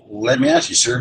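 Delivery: normal talking voice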